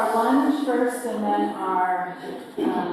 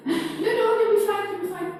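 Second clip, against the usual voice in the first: high, laughing reassurance; giggling through the words; 12 semitones higher, 3 dB louder than usual. Real, not acted. Laughing while reassuring class.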